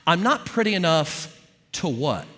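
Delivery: firm, pointed challenge; challenging the inner voice. Acted. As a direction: throw the accusation back as a demand. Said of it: "You say to that voice".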